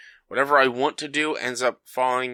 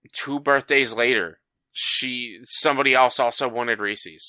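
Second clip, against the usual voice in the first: ordinary talk, nothing marked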